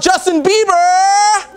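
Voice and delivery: big slow, loud high call of the name; announcer shout. Acted. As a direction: hype it like a ring announcer. Host announcing the guest.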